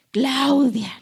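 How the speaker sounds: llamado fuerte y alargado por su nombre; voz audible de Dios. Pretend. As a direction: imita a Dios llamando a alguien, con volumen. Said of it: "va a escuchar así". (voz imitada de Dios llamando)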